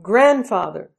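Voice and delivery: put-on child crying out. A narrator's reading. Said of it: "Jolly cried".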